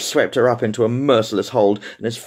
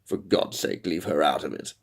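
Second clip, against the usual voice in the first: tense low husband; 4 semitones lower, 6 dB quieter than usual. Acted.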